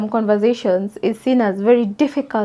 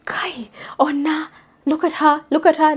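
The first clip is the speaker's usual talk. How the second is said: whispered snide gossip building up; mocking gossip; 3 semitones higher, 3 dB quieter than usual. Pointing at a stained girl, snide whispered gossip. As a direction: hiss it like a gossiping onlooker. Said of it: "Not be like".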